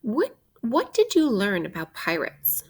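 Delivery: usual speaking voice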